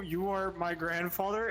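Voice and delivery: usual speaking voice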